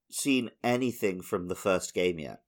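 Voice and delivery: usual speaking voice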